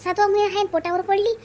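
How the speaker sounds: high-pitched village woman spreading worried news; anxious village gossip. Acted. Ganga tells Shantabai about the pregnant girl's fall, high and fretful.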